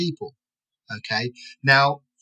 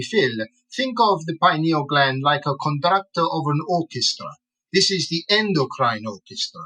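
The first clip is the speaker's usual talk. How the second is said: lilting imitation of an Italian gentleman; Italian accent; 3 semitones higher than usual. An Italian gentleman explains the pineal gland to Phil, in an imitated Italian accent. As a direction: put on a musical Italian accent. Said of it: "He's an Italian gentleman".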